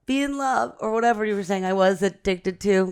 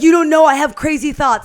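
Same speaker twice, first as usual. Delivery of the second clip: louder, higher hammed-up dramatic boyfriend; mock intense boyfriend; 6 semitones higher, 8 dB louder than usual. Mocking her date's dramatic confession, a hammed-up loud whine. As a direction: overplay him a little. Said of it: "he's like".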